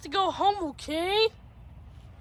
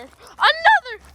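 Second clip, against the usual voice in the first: excited; 11 semitones higher, 7 dB louder, much slower than usual. Real, not acted. Excited at spotting dog.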